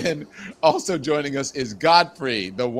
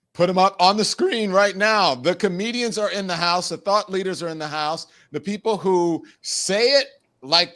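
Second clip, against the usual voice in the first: show host announcer; 4 semitones higher than usual. Acted. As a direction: welcome the guests like a show host. Host opens show segment.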